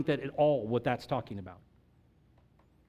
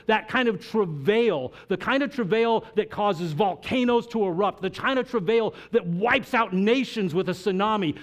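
preaching at full force; 4 semitones higher than usual. Acted. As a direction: build the fervor line by line. Builds to preaching climax.

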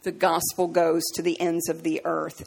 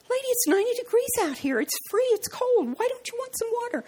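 retold coaxing. Pleading with rude stranger, retold.

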